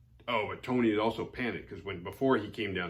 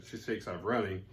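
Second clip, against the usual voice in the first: ordinary talk, nothing marked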